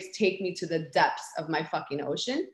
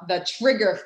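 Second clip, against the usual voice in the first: ordinary talk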